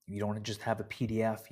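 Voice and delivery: ordinary talk, nothing marked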